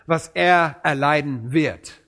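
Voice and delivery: ordinary talk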